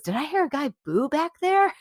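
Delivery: high playful voice, lightly put on; mock surprised celebrity; imitating Ewan. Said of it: "and he goes".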